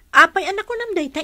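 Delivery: high, loud, fast surprise; surprised. Acted. Mother surprised by her cousin's teasing, quick and high. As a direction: blurt it out in surprise.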